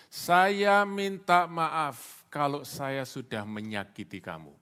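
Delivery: slow, deliberate model apology, loud then dropping away; insincere apology. Shown as a demonstration. Preacher showing a classic insincere apology, slow and deliberate. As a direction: spell out the fake apology slowly. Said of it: "Itu permintaan maaf yang tidak tulus".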